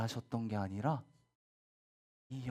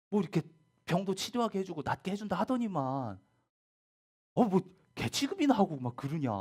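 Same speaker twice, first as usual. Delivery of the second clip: loud, higher-pitched mock indignation; indignant grumbling; 5 semitones higher, 5 dB louder than usual. Offended seeker grumbling about being treated like a dog, indignant. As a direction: complain like someone badly let down. Imagined offended reaction to harsh words.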